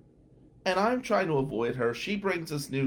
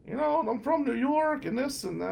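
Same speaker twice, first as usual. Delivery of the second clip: high, put-on voice of the eager talker; mock nasal chatter; 12 semitones higher than usual. Eager classmate telling his life story, high mock voice.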